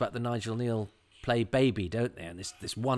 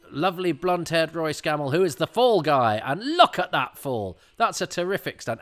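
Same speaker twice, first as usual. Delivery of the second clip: raised, delighted running commentary; real excitement; 6 semitones higher, 6 dB louder than usual. Real, not acted. Fan pointing out a terrific stunt fall, excited. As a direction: marvel aloud, voice high and pushed.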